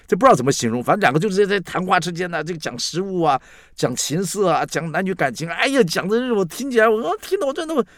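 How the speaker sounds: loud, high-pitched exasperated outburst; worked-up distress. Real, not acted. Commentator of sixty pained by food-and-romance talk, wailing distress. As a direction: wail in protest, climbing very high. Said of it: "我讲的是很难过".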